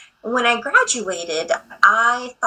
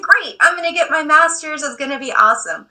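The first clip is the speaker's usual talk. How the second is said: high, eager self-quote; lightly hammed-up enthusiasm; naive excitement; 6 semitones higher than usual. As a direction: replay your own over-optimistic cheer. Acting out her naive optimism.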